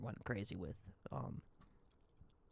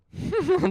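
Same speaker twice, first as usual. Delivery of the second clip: loud burst of laughter; 13 dB louder than usual. Real, not acted. Laughing at found Reddit slander.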